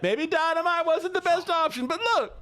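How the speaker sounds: very high squeaky excuse; high-pitched defensive squawk; flustered protest. Real, not acted. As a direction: plead your case up in a squeal. Defends failed plan to chat.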